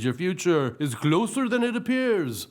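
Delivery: mysterious seer voice; fortune teller. Acted. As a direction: intone like a carnival psychic. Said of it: "she says".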